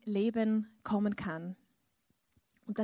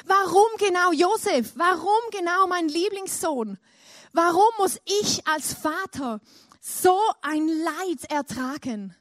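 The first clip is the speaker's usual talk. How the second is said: loud, high anguished plea to God; grieving father crying out to God; desperate cry; 6 semitones higher, 7 dB louder than usual. Retold or imitated. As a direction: cry out why to heaven in despair. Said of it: "fast verzweifelt ist und gefragt hat".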